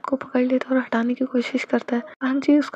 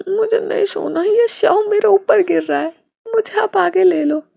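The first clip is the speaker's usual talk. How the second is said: put-on much higher, louder little child's whine; whiny child; 7 semitones higher, 5 dB louder than usual. A narrator's reading. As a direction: complain like a cranky small kid. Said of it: "जुन". (चिड़चिड़े छोटे बच्चे की शिकायत)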